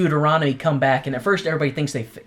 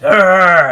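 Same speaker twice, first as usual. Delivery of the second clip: angry pirate growl; 4 semitones higher, 11 dB louder than usual. Retold or imitated. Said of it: "Macavity's like".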